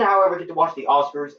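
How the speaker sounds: ordinary delivery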